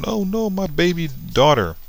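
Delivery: grieving father doing overdone fake crying; mock sobbing. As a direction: wail it in a put-on high voice, mocking the grief. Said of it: "starts crying".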